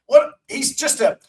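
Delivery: gushing radio announcer; Sterling impression. Imitating Sterling's excited call.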